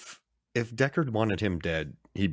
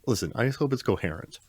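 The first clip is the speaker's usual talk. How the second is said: ordinary talk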